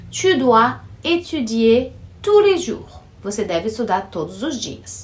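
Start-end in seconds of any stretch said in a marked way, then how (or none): 0.1-3.0 Tu dois étudier read slowly and clearly; slow example sentence. Shown as a demonstration. Teacher reading a model sentence aloud to students. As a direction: spell out the example at a careful pace.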